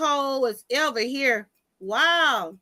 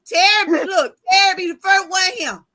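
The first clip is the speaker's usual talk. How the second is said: loud, higher call to a viewer; 5 semitones higher, 6 dB louder than usual. Real, not acted. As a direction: call out to Terry in the chat. Calling out to a viewer.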